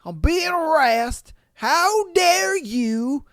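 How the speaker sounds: high, drawn-out wailing cry for help; hammed-up imitation of a woman yelling out in distress; mock yelling. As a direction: wail it slowly, high-pitched, making fun of her. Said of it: "now she's yelling out".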